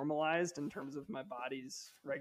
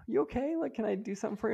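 much higher, gently concerned wife; imitating wife; 6 semitones higher, much faster than usual. Husband imitating his worried wife, mock higher voice. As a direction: voice the wife checking on him. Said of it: "And my wife was like".

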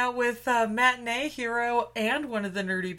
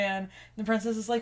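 ordinary delivery

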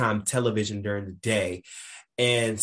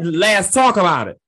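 loud quote; 7 semitones higher, 10 dB louder than usual. Retold or imitated. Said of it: "I said".

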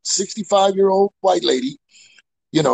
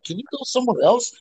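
mock prim lady; 4 semitones higher than usual. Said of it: "quoted lady again".

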